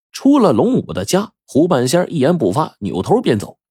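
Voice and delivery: usual speaking voice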